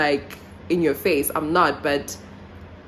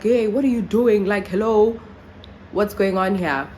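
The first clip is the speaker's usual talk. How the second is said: raised, higher mock shout; retold shout; 4 semitones higher, 3 dB louder than usual. Shouting at someone trying her door, retold raised voice. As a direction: call out in protest, not at full volume. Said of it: "so I shout at them".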